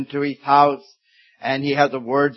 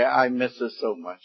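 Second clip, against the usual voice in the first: quiet grief; 3 semitones lower, 8 dB quieter than usual. Retold or imitated. Grieving widower quoted.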